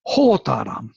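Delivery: "Hotaram" with the accent up front; stress on first syllable. Shown as a demonstration. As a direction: hit "Ho" in "Hotaram", let the rest drop. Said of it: "on the first syllable".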